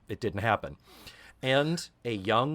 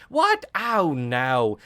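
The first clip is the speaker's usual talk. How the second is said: Cockney; 7 semitones higher, 5 dB louder, slower than usual. Retold or imitated. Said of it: "And she says".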